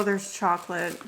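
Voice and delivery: normal talking voice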